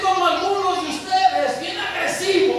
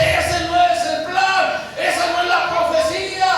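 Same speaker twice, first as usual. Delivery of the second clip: firm loud declaration; 4 semitones higher, 5 dB louder than usual. Acted. Jesus telling Peter the plan, loud and firm. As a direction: declare it like a final word.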